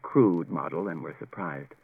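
a polite machine stating facts; calm robot voice. A narrator's reading.

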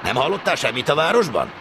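high questioning rising sharply, then dropping; probing stationmaster. Acted. Stationmaster asking trainee about town gossip, probing. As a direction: press the trainee for news. Chief interrogates his trainee.

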